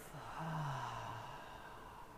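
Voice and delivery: long soft sigh out the mouth; audible exhale. Shown as a demonstration. Teacher breathing along with listeners, long audible exhale. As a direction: breathe all the way out, softly and audibly. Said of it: "audible exhales together".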